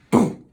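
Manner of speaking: loud spoken boom; sudden crash boom; boom sound effect. A narrator's reading. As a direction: bang it out big. Final crash.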